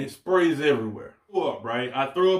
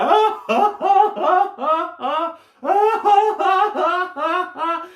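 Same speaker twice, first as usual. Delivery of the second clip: very high, loud mocking laugh; imitated laughing captain; 5 dB louder, much slower than usual. Imitating the captain laughing at the soaked fisherman. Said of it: "the captain is dying laughing".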